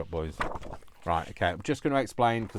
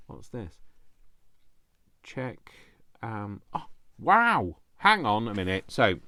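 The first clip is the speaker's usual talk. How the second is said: ordinary talk, nothing marked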